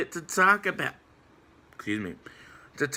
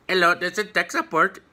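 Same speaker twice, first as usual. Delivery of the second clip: a hammed-up, higher Indian accent; bad Indian accent; 4 semitones higher than usual. Vlogger jokingly playing tech support caller Raj, bad Indian accent. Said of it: "that was a really horrible Indian accent".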